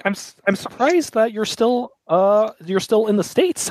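a hint of a smug puppet master; puppeteer voice. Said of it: "has the voice of a puppeteer".

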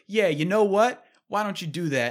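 cocky comeback. Retold or imitated. Retold comeback in an argument.

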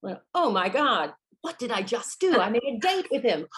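a flustered gasp of realisation; dawning panic. Acted. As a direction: realise what she just did. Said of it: "my whole face changed, going".

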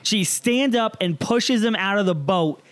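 normal talking voice

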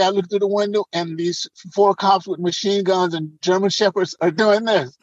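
ordinary talk

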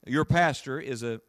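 ordinary talk

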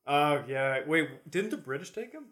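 normal talking voice

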